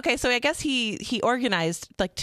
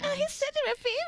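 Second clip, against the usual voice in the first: swooning; 5 dB quieter than usual. Pretend. Teasing about a crush.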